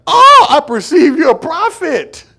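high, slow, loud awe; amazed woman. Acted. Preacher voicing the Samaritan woman amazed at Jesus, high and slow. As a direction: gasp it out in wonder.

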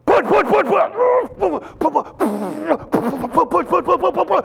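high, loud gym spotter shouting; spotter yelling push. Retold or imitated. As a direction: bark it like a spotter urging a lift. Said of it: "He said".

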